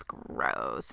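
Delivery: soft, disgusted whisper; whispered gross. Acted. Reacting to the show's sex scene, whispered disgust. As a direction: mutter it in a hushed whisper.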